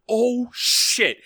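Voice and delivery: high, drawn-out oh-no moment; mock panic, pitched well up; retold alarm. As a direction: reenact the shock of walking in on someone. Retells finding principal asleep.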